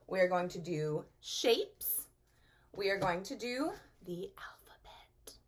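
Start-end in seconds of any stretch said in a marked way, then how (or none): 4.0-5.4 a playful whisper to the class; soft teasing whisper. Acted. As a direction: whisper it like a secret. Whispered line in lesson.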